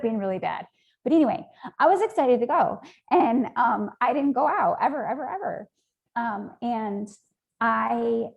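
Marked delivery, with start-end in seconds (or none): 1.0-5.7 higher and louder, bubbling with excitement; eager, raised pitch and volume; excited. Real, not acted. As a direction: let excitement lift the voice. Excited retelling of concert plans.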